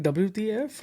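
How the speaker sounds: high, incredulous WTF; disbelieving WTF. Retold or imitated. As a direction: throw it out in shocked disbelief. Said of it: "And I'm like".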